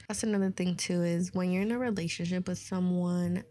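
ordinary talk

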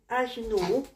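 normal talking voice

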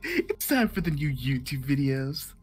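YouTuber voice. Shown as a demonstration. Streamer showing friends a fake intro, YouTuber voice. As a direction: put on a mock YouTuber welcome. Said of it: "you put in a different voice".

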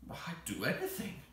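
mildly tearful creature voice; a touch of tears in the creature's voice. A narrator's reading. The much moved Psammead answers Anthea, tearful. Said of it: "in a tearful voice".

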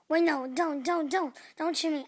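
loud, frantic hammy pleading; panicked begging. Pretend. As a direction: beg loudly not to be shot. Begging not to be shot.

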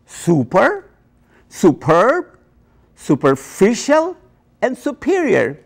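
"Super, superb, superficial, and superior", each set apart by pauses; four different pronunciations. Shown as a demonstration. Immigrant professor showing handbook oddities, "Super, superb, superficial, and superior" pronounced distinctly. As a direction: show the shifting stress: SU-per, su-PERB, super-FI-cial, su-PE-rior. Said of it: "are pronounced four different ways".

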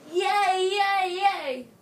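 loud, bouncy cheer; excited cheering. Acted. Grandchild cheers that grandpa will go, loud excited. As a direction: cheer like a kid who got their way.

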